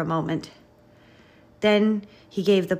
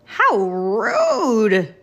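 loud, stretched, indignant truck voice; belching cement mixer; 4 semitones higher, 8 dB louder than usual. A narrator's reading. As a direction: belch out the affront. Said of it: "belched Cement Mixer".

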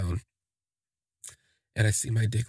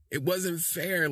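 higher swooning repeat; 7 semitones higher, slower than usual. Real, not acted. Gushing to viewers about his smile, a higher swooning repeat.

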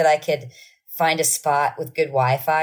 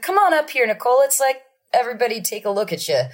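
higher, cheery showman; showman calling; 8 semitones higher, faster than usual. Retold or imitated. Mimicking singer calling child on stage. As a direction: invite her onstage like a host.

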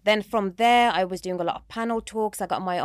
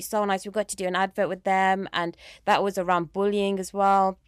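normal talking voice

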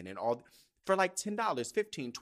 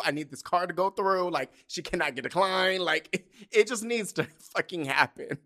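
higher, louder, urgent with laughs breaking in; retold frantic wish, hammed up and half-laughing; desperate pleading; 5 semitones higher, 5 dB louder than usual. Teen willing mom's card to go through, desperate.

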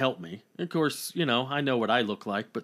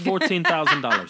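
laughing; 7 semitones higher, 6 dB louder than usual. Real, not acted. Joking about price, laughing.

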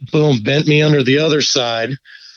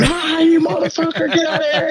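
high, strained yell held back in volume; retold yell. Angler yelling at the animal on his fish, high mock yell. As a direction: imitate yelling at the top of your lungs, without real loudness. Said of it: "I'm yelling at the thing as loud as I can".

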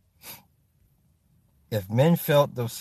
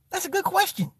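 high mock-thoughtful squeak; 5 dB quieter than usual. Real, not acted. Repeats the question mockingly.

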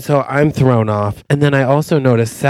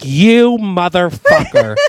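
mock outrage; 7 semitones higher, 3 dB louder than usual. Acted outrage at the oil heir.

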